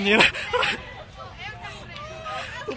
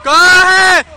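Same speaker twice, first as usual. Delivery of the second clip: shouting; 4 semitones higher, 8 dB louder, much slower than usual. Real, not acted. Urging latecomer to run to gate.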